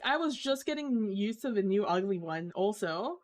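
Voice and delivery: ordinary delivery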